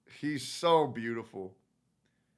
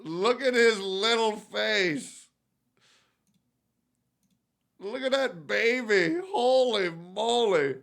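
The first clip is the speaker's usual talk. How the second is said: cooing; 6 semitones higher than usual. Real, not acted. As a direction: coo at the kitten. Cooing at baby cat.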